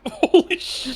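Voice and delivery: slow, loud laughing shout; laughing exclamation. Real, not acted. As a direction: burst out laughing in shock. Player reacts laughing.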